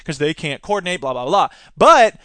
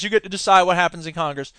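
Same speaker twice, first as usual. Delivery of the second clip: normal talking voice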